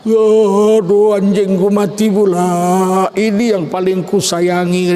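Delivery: hammed-up wail of grief; exaggerated, drawn-out mourning lament; mock crying. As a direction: bawl theatrically over a beloved pet. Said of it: "anjingnya mati dia nangis".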